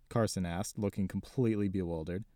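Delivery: usual speaking voice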